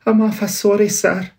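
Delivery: a meek clerk begging pardon; apologetic Bob. Acted. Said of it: "said Bob".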